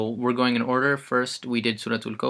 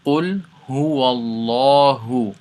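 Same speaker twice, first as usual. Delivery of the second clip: قُلْ هُوَ اللَّهُ chanted, louder, lips unrounded; Quran recitation; 5 dB louder, faster than usual. Shown as a demonstration. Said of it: "One more time".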